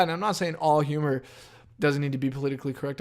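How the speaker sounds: ordinary talk, nothing marked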